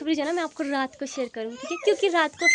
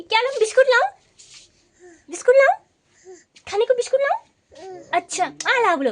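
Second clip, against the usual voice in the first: high sing-song coaxing; 9 semitones higher, 8 dB louder than usual. Real, not acted. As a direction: coax the little one, pitch way up. Mother coaxing toddler about biscuits. (बच्चे को मनाती ऊँची मीठी आवाज़)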